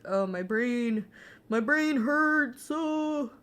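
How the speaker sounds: hammed-up complaint, slow and high; mock whine. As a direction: ham up the aching brain. Whining about tired brain.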